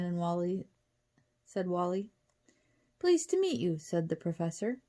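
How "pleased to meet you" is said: high, polite British greeting; calm British accent. A narrator's reading. Professor meets the twins, courteous British accent. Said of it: "in a calm voice with a British accent".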